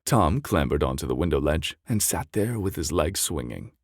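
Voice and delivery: ordinary delivery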